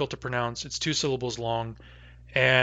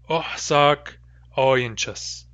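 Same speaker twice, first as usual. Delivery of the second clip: slow demo pronunciation; much slower than usual. Shown as a demonstration. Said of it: "the way I would probably say it".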